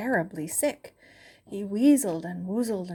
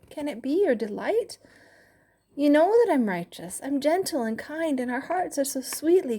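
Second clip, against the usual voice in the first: pleading to God; 7 semitones higher, 3 dB louder than usual. Acted.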